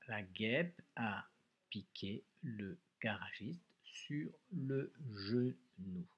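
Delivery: "la guêpe a piqué le garagiste sur le genou" read slowly, each word laid out; slow, careful dictation pace for children to write along. Shown as a demonstration. Teacher rereading the sentence to pupils, slow and clear. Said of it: "On relit ensemble une dernière fois".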